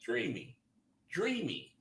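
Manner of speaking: dreamy murmur. Shown as a demonstration. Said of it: "Sounds dreamy".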